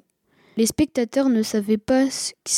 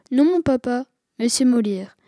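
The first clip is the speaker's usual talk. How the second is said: usual speaking voice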